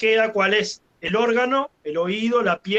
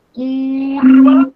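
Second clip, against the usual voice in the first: loud, higher, long held U in "curva"; "cuuuurva", the U drawn right out; stretched U; 4 semitones higher, 11 dB louder than usual. Shown as a demonstration. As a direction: hold the CUR of "curva" as it swells louder. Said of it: "para el acento".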